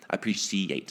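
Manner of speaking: "appreciate" said in full, ending on a clear "ate"; every syllable pronounced. Shown as a demonstration. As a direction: carefully give "appreciate" all its syllables. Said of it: "I said all of it this time".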